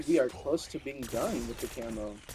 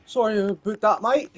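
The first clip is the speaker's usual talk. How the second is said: higher, louder Aussie apology; cheeky Australian accent, raised and loud; 8 semitones higher, 10 dB louder than usual. Pretend. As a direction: toss it off like an Australian bloke. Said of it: "two different accents in one".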